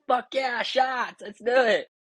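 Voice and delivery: high, pumped-up yell for shots; excited cheer. Retold or imitated. Soldier agreeing to tequila shots, excited cheer. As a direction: cheer like the party just started.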